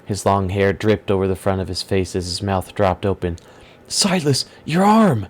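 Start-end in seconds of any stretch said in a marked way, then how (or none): 3.9-5.3 sudden high alarmed shout; high, louder cry of alarm. Acted. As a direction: cry out in shock at the wound. Torin sees Silas wounded.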